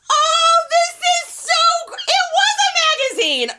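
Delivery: high, loud gushing shout; thrilled shout. Real, not acted. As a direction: squeal it out, overjoyed. Opens a magazine gift.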